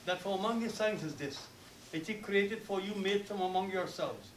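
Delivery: usual speaking voice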